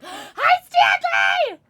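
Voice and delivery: gasp, then a high, loud hello; excited shout hello. Real, not acted. Streamer greeting viewer Stanley, delighted shout.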